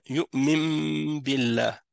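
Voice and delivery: slow "Yu'mim billah", long hummed M before the B; noun pronounced as mim. Shown as a demonstration. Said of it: "le noun se transforme en mim".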